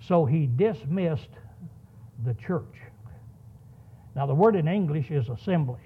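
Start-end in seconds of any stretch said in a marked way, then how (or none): none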